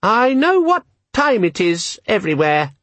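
a tiny demon selling its features; high, eager electronic assistant; chirpy gadget. A narrator's reading. Disorganizer voice.

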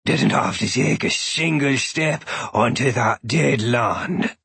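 slow low growl; gruff old man voice, slow; deep growly voice. A narrator's reading. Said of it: "a deep, growly voice says".

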